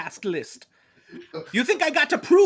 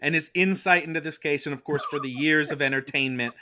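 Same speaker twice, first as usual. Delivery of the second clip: low, grave courtroom voice; solemn lawyer; 10 semitones lower, 4 dB quieter than usual. Acted. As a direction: deliver it like a somber attorney.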